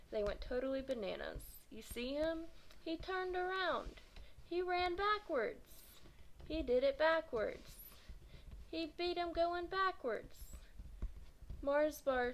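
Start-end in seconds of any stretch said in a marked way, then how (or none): 1.7-10.6 high, excited voice of the crowd; amazed crowd. Acted. A kid in the crowd marvels at the win, high and excited. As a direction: marvel at what you just saw.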